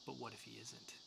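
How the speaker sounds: a soft, hushed sinister question; sinister quote. Retold or imitated. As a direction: let the menace sink in quietly. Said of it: "really sinister and dark".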